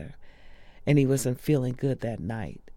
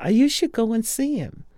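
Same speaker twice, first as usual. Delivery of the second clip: urging; 10 semitones higher, 5 dB louder than usual. Retold or imitated. Urging Patti, high loud.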